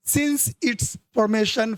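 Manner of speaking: slow, deliberate classroom reading; slow dictation read. Shown as a demonstration. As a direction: read the line out very slowly. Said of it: "slow sentence reading".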